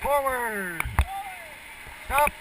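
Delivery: loud, high calls over the river noise; shouting over rushing water. Real, not acted. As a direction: bark the paddle commands over the rapids. Guide shouting paddle commands in rapids.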